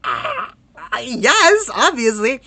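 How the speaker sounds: high, loud mock squeal of delight; excited retold reply. Retelling their answer to a teaching job offer, excited. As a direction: gush an over-eager yes. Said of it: "And I was like".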